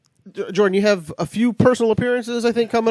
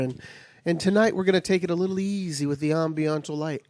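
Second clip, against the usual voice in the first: ordinary talk, nothing marked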